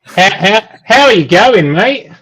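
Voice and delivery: high-pitched, hammed-up Australian accent; bad Australian accent. Imitating how Australians greet people, bad Australian accent. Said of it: "not a good Australian accent".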